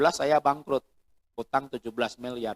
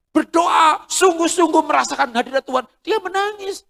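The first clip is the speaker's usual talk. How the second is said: much higher and louder, overwrought; emotional praying wife; 14 semitones higher, 8 dB louder than usual. Retold or imitated. Preacher imitating a wife praying in tears, high and loud. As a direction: mimic a wife weeping in prayer.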